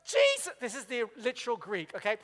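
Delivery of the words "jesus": much higher, louder indignant call of a name; fed-up plea; exasperated cry. Retold or imitated. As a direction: Martha calling Jesus out in frustration. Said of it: "Martha comes in and goes".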